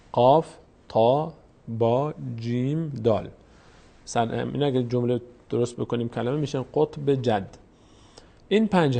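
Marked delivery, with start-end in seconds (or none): 0.1-3.3 each letter named slowly and separately; name each letter with a pause; spelled out slowly. Shown as a demonstration. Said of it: "کلمهٔ قطب جد".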